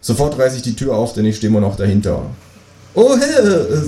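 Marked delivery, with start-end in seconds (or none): 3.0-3.9 much higher, louder startled cry; surprised kangaroo. Acted. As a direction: jump back in high-voiced surprise. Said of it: "sagt das Känguru überrascht".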